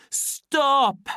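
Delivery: very high, loud cry; loud, ringing shout. A narrator's reading. Savage interrupts the soma distribution, ringing shout. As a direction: ring it out to halt everyone. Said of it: "in a loud and ringing voice".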